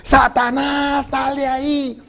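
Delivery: high, loud, slow mock exorcism yell; retold shouting pastor. Preacher imitating the pastor yelling at the woman, loud and high. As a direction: bellow it like a pastor casting out a demon. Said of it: "y gritaba".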